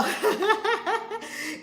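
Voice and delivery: high rippling giggle; real laughter. Real, not acted. Host giving disclaimers to viewers, breaking into laughter.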